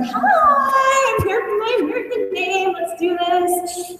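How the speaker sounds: put-on a thrilled child ready for her new name; eager little girl. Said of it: "and they're like".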